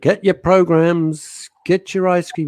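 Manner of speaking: loud, high, drawn-out seller's cry; imitated ice-cream seller's holler; theatre vendor call. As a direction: call out your wares to the whole theatre.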